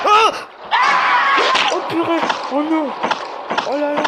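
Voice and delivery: high, loud cries of fright; real frightened cry. Real, not acted. Gamer gets the fright of their life, crying out. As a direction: cry out as if scared for your life. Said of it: "J'ai eu peur".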